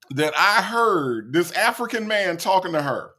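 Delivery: usual speaking voice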